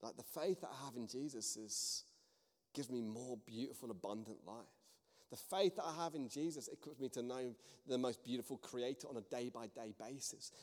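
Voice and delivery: soft, low, gentle earnestness; hushed, tender testimony; sincere. Retold or imitated. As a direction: say it quietly and sincerely. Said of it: "smiled at her sincerely and said".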